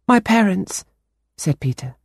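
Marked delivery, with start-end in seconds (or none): 0.1-0.8 put-on higher, earnest young boy voice. A narrator's reading. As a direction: play the anxious ten-year-old boy. Boy Peter voiced in story.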